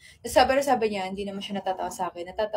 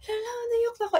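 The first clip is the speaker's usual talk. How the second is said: much higher, half-laughing "Hala, naiyak na ako"; squeaky laughing cry; 13 semitones higher, faster than usual. Retold or imitated. Crying and laughing at once in front of friends, squeaky high. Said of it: "pero nakakatawa kasi".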